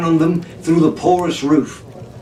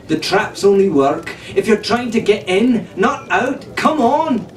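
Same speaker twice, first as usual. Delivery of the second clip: rising higher toward a high Come on; excited urgency; 5 semitones higher than usual. Acted. Arabella urging the crew to run, pitch climbing high.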